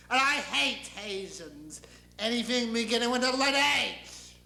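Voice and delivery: deity building in indignation; God. Acted. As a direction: spit out grand, irrational hatred. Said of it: "because I'm God".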